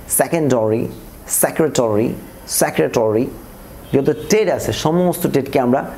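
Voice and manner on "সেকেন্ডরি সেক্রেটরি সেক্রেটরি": "সেক্রেটারি" as "sekretori"; corrected "-ori" ending. Shown as a demonstration. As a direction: round the a to o in "সেকেন্ডারি" and "সেক্রেটারি". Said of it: "অরিতে পরিণত করব".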